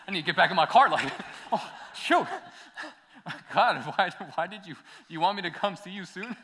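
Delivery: panicked, high and loud, laughing through it; half-laughing panic, voice jumping up; freaking out. Retold or imitated. To the ex-con in the car, retold freaking out, laughing. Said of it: "I was freaking out".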